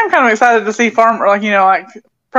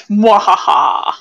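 slow evil villain laugh; sneering panto villain; much slower than usual. Acted. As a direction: cackle like a hammy villain. Mayor of the internet joke.

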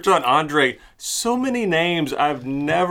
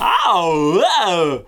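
Christopher Walken impression; 3 semitones higher, 4 dB louder than usual. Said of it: "soft talking Christopher Walken".

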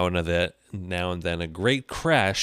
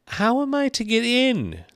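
high, loud Alice voice; very high-pitched, raised-voice demand; repeated aloud; 6 dB louder than usual. A narrator's reading. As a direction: call it out shrilly high as an insistent Alice. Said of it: "she repeated aloud".

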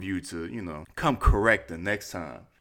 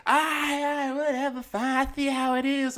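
high, huffy mock girly voice; high pouty imitation of her giving up; mimicking her sulky reply.

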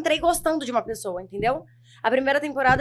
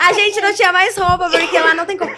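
laughing; 4 semitones higher, 7 dB louder than usual. Real, not acted. Laughing about weight gain.

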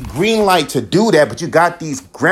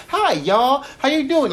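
lightly imitated Texas twang, pitched high; a hint of wife's Texas drawl; Texas accent; 12 semitones higher than usual. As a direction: Texas greeting in a high mock voice. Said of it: "she always say".

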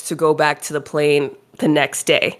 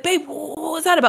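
puzzled; 12 semitones higher than usual. Retold or imitated. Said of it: "He's like".

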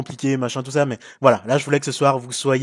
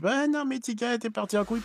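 mock viewer complaint; 8 semitones higher, 7 dB quieter than usual. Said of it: "qu'après vous me dites".

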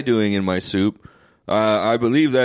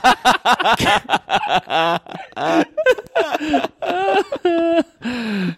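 long high cracking laugh; high laughter. Real, not acted. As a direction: laugh hard, almost crying. After the rap.

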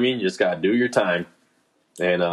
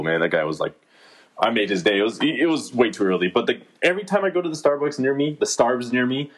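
ordinary delivery

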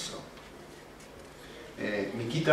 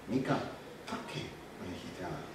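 soft hushed question; 6 semitones lower, 11 dB quieter than usual. Retold or imitated. Father asks daughter gently.

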